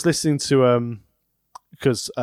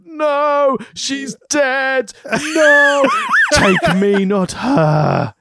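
high, loud hammed-up melodrama; mock theatrical actor; 6 dB louder, much slower than usual. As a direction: overact it like a bad stage tragedian. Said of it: "mock theatrical actor of".

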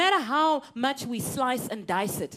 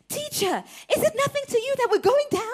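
high, fast, panicked cry; panicked disciples; 13 semitones higher, 5 dB louder than usual. Acted. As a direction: sound like men sure the boat is sinking.

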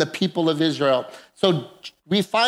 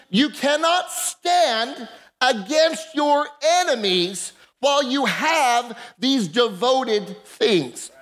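rising, building preacher's cadence; preaching; 4 semitones higher than usual. Acted. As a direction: build it up from the pulpit.

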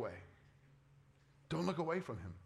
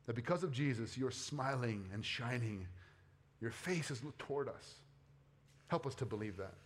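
quiet prayer; 4 semitones lower, 4 dB quieter than usual. Real, not acted. Closing prayer.

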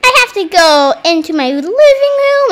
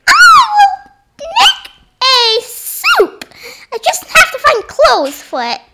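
squeaky toy dog; 12 semitones higher, 4 dB louder than usual. Pretend. Child voices toy dog Fetch.

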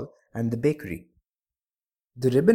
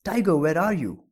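higher-pitched call after a lost dog; crying out; 6 semitones higher, 3 dB louder than usual. A narrator's reading. Blind man calls his runaway dog, crying out. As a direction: call out for the dog, pitch leaping then falling. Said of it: "he cried".

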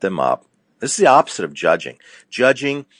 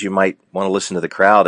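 ordinary delivery

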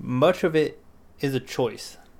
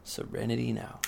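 calm, quiet mantra; 9 dB quieter than usual. Shown as a demonstration. Friend explaining the technique, calm quiet mantra. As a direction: softly say it to yourself, calm. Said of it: "you calmly say to yourself".

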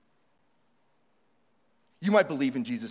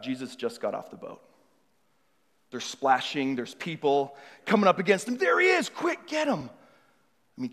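guided imagining. Acted.

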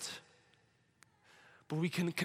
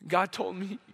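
normal talking voice

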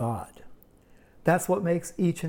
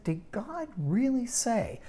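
higher, slow, insinuating question; slow sly tempter's question; sly doubting serpent; 8 semitones higher, slower than usual. Acted. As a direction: plant doubt with a sneer. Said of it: "And you hear the tone of voice?".

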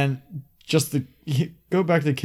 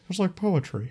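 quoting George Lucas; 6 semitones higher, 5 dB quieter than usual. Retold or imitated. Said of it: "Like George Lucas said".

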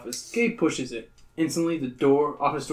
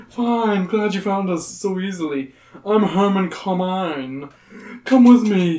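slow, higher creepy host; Herman Carmine; 5 semitones higher, 5 dB louder, slower than usual. Acted. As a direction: lure them in slowly.

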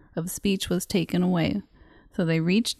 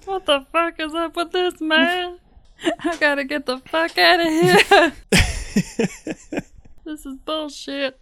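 panicked victim; 8 semitones higher than usual. Acted. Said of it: "zombie that steals Tom's voice".

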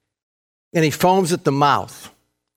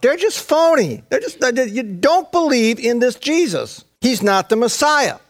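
high-pitched, scornful debunking; acted high-voiced heckling; scornful accusation; 8 semitones higher than usual. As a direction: denounce a fraud to a crowd. Acting scribes jeering to the crowd.

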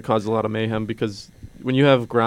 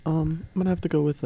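high, soft and hesitant; small, raised-pitch voice; 5 semitones higher, 6 dB quieter than usual. Real, not acted. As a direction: speak up high and too quietly. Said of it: "Why is your voice so high?".

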